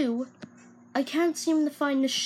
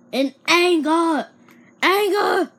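loud, higher roaring of anger; hammed-up angry shout; 7 semitones higher, 9 dB louder, slower than usual. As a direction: bellow the word anger like a madman. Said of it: "stab someone in anger".

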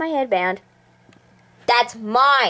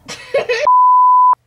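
high burst of laughter; loud shrieking laugh; 4 dB louder than usual. Real, not acted.

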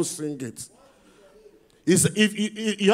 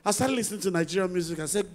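usual speaking voice

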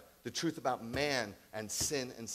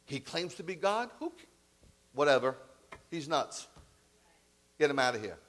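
dismissive Pilate; 3 semitones higher, 6 dB louder than usual. Acted. Pilate voiced dismissing blasphemy.

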